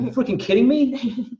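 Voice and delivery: high-pitched incredulous New York voice; New York accent. Retold or imitated. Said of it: "in his New York accent".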